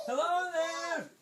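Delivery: high, loud, drawn-out hello; slow, high storybook holler; calling in insistently. Acted. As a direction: stretch the call into the shell. Calls into shells, second try.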